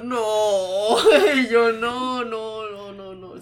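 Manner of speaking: long wailed no, dying away; dreading refusal. Retold or imitated. Barista hears about the bathroom, mock-wailing dread.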